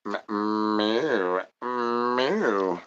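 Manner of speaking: soft, drawn-out mock meow; imitated cat meow. Streamer playing cat after his catchphrase, soft mock meow. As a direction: meow quietly with a lingering hum on the m.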